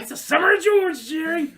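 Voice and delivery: excited George shout; high, loud mock George yell. George to Jerry, shouting his summer plans. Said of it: "He's like".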